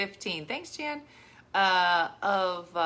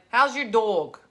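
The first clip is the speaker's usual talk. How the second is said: loud Jersey vowel on "dog"; mock Jersey question leaning on "dog"; Jersey accent; 7 dB louder, slower than usual. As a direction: imitate a New Jersey "dog", drawn to "dawg". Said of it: "until somebody starts talking about a dog".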